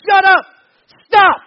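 furious high shout; explosive, very loud bellow; yelling. Acted. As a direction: yell a command at the storm. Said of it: "yells, and says".

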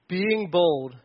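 ordinary talk, nothing marked

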